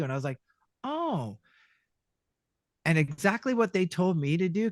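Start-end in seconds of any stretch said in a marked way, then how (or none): 0.8-1.4 soft, high "oh" falling away; quiet retold surprise; dawning realization. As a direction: softly realize it, pitch up.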